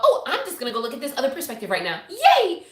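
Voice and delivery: airy pretend enthusiasm with a squealed finish; mock cheeriness. Speaker mocking the idea of simply switching perspective, mock cheery.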